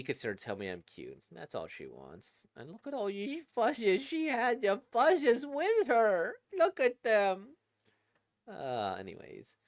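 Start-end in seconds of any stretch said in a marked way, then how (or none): 2.6-7.6 cutesy gushing. Real, not acted. Reviewer gushing over the cute plushies she had with her, squealing baby talk. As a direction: coo over them, stretching the words out high.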